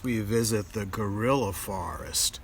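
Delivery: ordinary delivery, nothing marked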